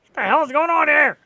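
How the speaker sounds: loud, high cry of disbelief; exasperated outburst. Real, not acted. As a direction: throw up your hands at the standings, loud. Exasperated at sports standings.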